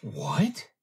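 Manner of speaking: sharp high startled question; a high-pitched startled what. Acted. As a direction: jump up in shock on the word. Meyer startled by refusal.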